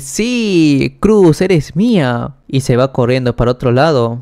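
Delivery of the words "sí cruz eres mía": high, drawn-out cheer; gleeful, voice lifted and lingering; overjoyed. Acted. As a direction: celebrate with a stretched, triumphant cry. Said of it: "Jan todo feliz".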